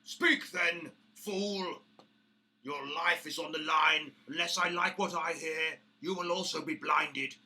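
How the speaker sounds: loud, high menacing orc; threatening orc boss. Acted. Orc boss threatening the visitor Bramble, loud menace. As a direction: bark the threat down at him.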